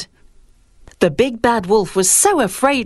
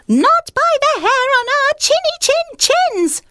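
much higher squeaky piglet voices; squeaky little pigs. Acted. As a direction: squeal it out like tiny defiant pigs. Pigs refuse from brick house.

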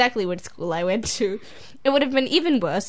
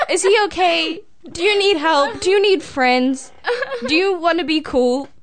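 raised pitch, spaced-out questions; mock concern; 6 semitones higher, 3 dB louder than usual. Asking the absent classmate if he needs friends, mock-concerned. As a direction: play at worrying for him, pitched up.

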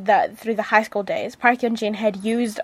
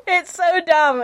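laughing; 8 semitones higher, slower than usual. Real, not acted. Gushing over cheesy kiss scene.

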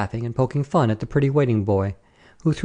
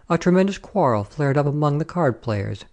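ordinary delivery

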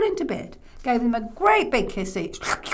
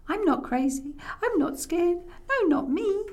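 put-on high, small child voice; brave little boy; 8 semitones higher than usual. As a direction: insist like a boy putting on bravery.

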